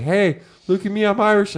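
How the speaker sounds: mock braggart. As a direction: parody someone showing off. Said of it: "they're like".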